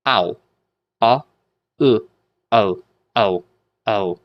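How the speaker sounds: "Ow" split into "Ah" and "Uh", then merged; teacher's demo of ah gliding into oo; blended diphthong. Shown as a demonstration. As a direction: sound out the parts of "Ow", then repeat it blended. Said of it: "two letters sort of blending".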